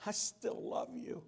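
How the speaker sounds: soft, hushed words of comfort; a gentle voice forgiving a friend; Jesus softly reassuring Peter; tender. Acted.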